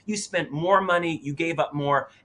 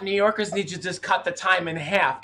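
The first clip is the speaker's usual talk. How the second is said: usual speaking voice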